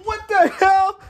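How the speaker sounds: high-pitched, baffled outburst; squeaky shocked reaction; incredulous. Real, not acted. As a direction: blurt it high in disbelief. Baffled reaction to game scene.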